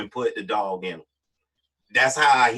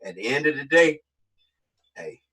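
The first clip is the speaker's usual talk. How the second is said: ordinary talk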